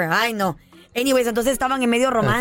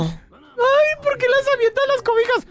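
very high squeaky complaining voice; mock whiny partner; falsetto complaint; 13 semitones higher than usual. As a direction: whine it in a high put-on voice.

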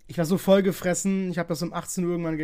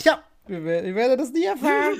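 neckend; 6 semitones higher, 5 dB louder than usual. Real, not acted. Teasing the listeners, laughing.

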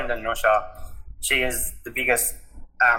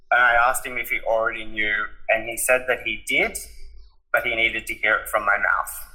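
ordinary talk

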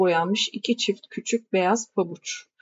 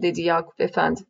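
ordinary delivery, nothing marked

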